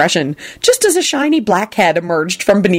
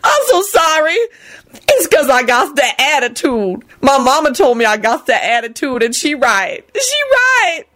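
high, tearful self-reproach; blubbering; 9 semitones higher than usual. A narrator's reading. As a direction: confess it through sobs. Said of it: "she blubbered".

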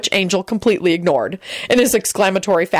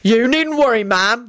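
raised, reassuring voice; mildly loud and higher; 5 semitones higher than usual. A narrator's reading. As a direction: speak up to reassure her. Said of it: "said in a loud voice".

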